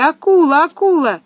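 high, loud alarm call; terrified cry. A narrator's reading. Children spot a shark near the boy, cry in horror. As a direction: cry out the warning in acted horror. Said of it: "Веселята закричали в ужасе".

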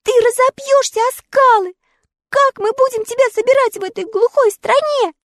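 put-on high, pleading girl's voice; girl begging the woodman not to climb, high and tearful; tearful plea. A narrator's reading. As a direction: beg him through tears. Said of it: "с плачем схватила его за руку".

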